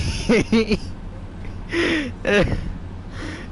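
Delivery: breathy high burst of laughter. Real, not acted. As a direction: laugh at your own story. Laughing at the story.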